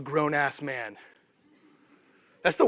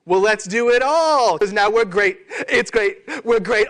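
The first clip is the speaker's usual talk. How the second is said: louder, higher, slower triumphant cheer; mock euphoria; 3 semitones higher, 6 dB louder than usual. Team cheering its new spike, in hammed-up euphoria. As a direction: overblown victory lap.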